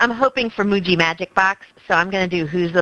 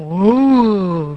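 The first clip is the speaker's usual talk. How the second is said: tree creature moan; 4 dB louder than usual. Retold or imitated. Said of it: "they go".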